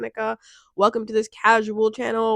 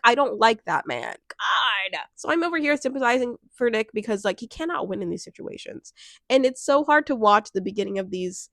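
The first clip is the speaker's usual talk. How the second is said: ordinary talk, nothing marked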